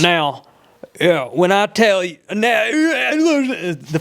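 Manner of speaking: Southern preacher drawl, rising and drawn out. Shown as a demonstration. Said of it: "it was my preacher voice".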